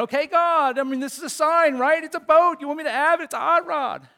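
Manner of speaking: high, loud, eager pleading with God; excited plea to God. Retold or imitated. Man at eBay pleading with God, high and excited. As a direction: talk God into the boat, pumped up. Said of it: "I'm thinking".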